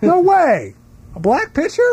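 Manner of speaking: high-pitched incredulous outcry; disbelieving baseball fans. Acted. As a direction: cry out in mock disbelief, voice shooting up. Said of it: "baseball fans not buying it at all".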